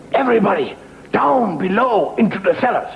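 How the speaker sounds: high, odd villager voice; urgent order. Acted. Villager sends the inn guests down, high urgent voice. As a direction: herd everyone down with urgency.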